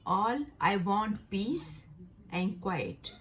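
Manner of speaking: teacher reading the example to students; slow example sentence. Shown as a demonstration. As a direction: dictate it slowly for learners. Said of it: "इसके जुमले हैं".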